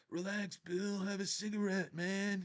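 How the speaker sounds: high, hushed put-on voice; movie hero impression. Voicing the other man in film scene.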